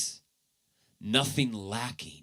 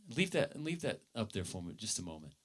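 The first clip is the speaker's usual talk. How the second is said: quiet aside; 8 dB quieter, faster than usual. Real, not acted. Aside to the tech team.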